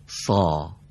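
سا with a full, heavy, back-of-mouth s and a deep aa; thick heavy sa. Shown as a demonstration. Teacher demonstrating heavy سا to a student. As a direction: say سا thick, like a heavy Arabic sad. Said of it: "مثل سین فارسی اما درشتر".

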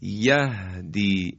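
the ه of یهدی breathed out gently, slow; soft, unhurried ha sound. Shown as a demonstration. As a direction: ease the ه of یهدی out softly and slowly. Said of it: "این باید نرم ادا بشه".

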